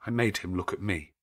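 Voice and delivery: plain reading voice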